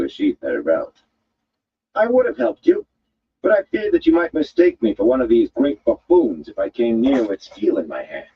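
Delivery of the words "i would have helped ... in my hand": higher, dry woman's voice; tart woman. A narrator's reading. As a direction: tease him with cool wit.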